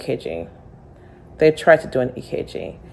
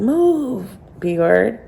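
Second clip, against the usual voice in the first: retold scream; 6 semitones higher, much slower than usual. Lady at the ER drop-off yells at her, mock high yell. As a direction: fling it at her like an angry stranger. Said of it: "this lady is screaming".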